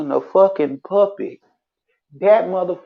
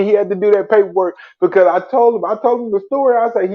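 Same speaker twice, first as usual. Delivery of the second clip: ordinary talk, nothing marked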